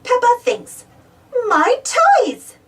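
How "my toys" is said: put-on high excited child exclaim; a glad little yell; eager shout. Peppa telling Mummy what she is thankful for, eager shout.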